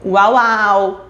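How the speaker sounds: imitating a toddler saying "au au"; "au au" like a baby's first word. Shown as a demonstration. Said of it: "como, por exemplo". ("au au" como um bebê)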